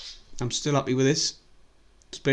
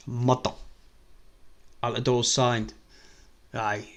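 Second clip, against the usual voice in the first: ordinary delivery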